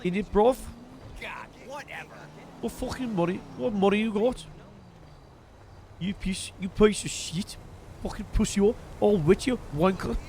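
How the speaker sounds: muddled mock-British slang insults; amateur British lad accent, drifting; put-on British accent. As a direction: try out a wobbly British accent. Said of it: "What is this accent turning into?".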